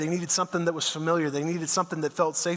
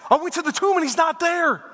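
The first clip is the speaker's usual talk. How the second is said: much higher, bursting with news; excited Mary; 7 semitones higher than usual. Retold or imitated. Mary bursting in on the hiding disciples, excited and high. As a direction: blurt it out like she ran all the way. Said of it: "She's all excited. She's out of breath".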